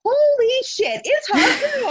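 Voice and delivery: high-pitched mock fanboy squeal; hammed-up starstruck outburst. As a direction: gush like an over-the-top fan spotting an idol. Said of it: "like a Make-A-Wish kid".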